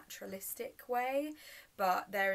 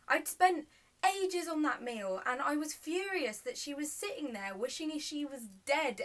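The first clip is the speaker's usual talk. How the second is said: raised, indignant stage fury; furious outburst; 8 semitones higher, 7 dB louder than usual. Acted. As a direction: spit out the anger at full volume. Recalls fury over ruined meal.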